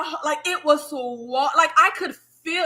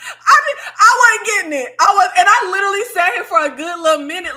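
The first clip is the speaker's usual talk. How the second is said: high, loud laughing rant; 6 semitones higher, 7 dB louder, faster than usual. Real, not acted. Confessing she didn't get it, laughing high and loud. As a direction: talk through the giggles, fast.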